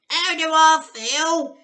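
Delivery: high, loud, slow witch screech; tiny grandma yelling in fury; furious yell. Acted. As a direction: screech it back at her. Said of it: "she yelled".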